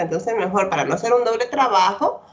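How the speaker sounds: ordinary talk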